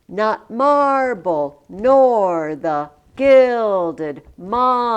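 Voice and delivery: slow, loud, beat hammered; sing-song meter. Shown as a demonstration. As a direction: overstress every other syllable. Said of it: "Da, da".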